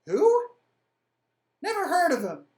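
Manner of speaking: shrill jeer of disbelief; mocking dismissal. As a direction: sneer down from the city walls. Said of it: "say something to the effect of".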